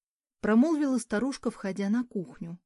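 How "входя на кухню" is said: ordinary delivery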